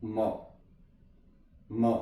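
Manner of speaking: मॉ said low and soft with no d sound; soft model word. Shown as a demonstration. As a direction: repeat मॉ gently. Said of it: "ये मड नहीं है". (मॉ धीरे और नरम)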